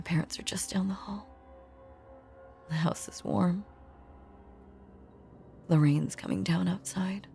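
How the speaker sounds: soft, hushed whisper lulling him to sleep; soothing whisper. Acted. Dead girl hushing the boy back to sleep, soft whisper. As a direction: hush him down like a child at bedtime.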